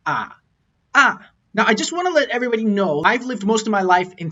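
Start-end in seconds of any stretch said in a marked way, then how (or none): 0.0-0.4 "ah" pitched low, sinking; brief low "ah" in a tone demonstration; low third tone. Shown as a demonstration. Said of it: "third tone is a dipping tone". 0.9-1.3 "ah" high and loud, dropping fast; forceful falling tone. Shown as a demonstration. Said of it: "start really high and come down forcefully".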